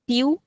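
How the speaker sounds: tiu as tee-oo; hard-t variant. Shown as a demonstration. Teacher lists allowed names, tiu with hard t. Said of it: "you can call it".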